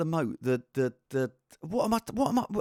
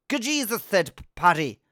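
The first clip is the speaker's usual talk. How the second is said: hammed-up Irish accent. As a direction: put on a stagey Irish accent. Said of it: "quite an offensive accent there".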